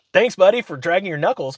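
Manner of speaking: high, mock-cheery jab; retold snide send-off; sarcastic thanks. Sarcastic thanks to slow buyer.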